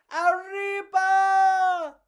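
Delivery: high, loud, slow triumphant cry; drawn-out cheer. Acted. Bugs cheering as the plane lifts off, long high whoop. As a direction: stretch the cheer as they take off.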